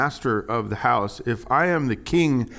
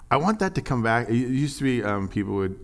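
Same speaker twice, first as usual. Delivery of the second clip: usual speaking voice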